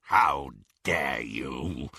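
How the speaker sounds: low, slow werewolf snarl. A narrator's reading. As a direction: growl it like a beast. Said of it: "he snarled".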